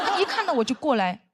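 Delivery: ordinary talk, nothing marked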